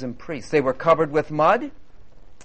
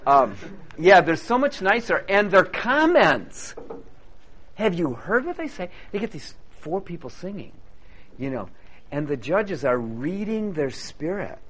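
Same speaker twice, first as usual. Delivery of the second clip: ordinary delivery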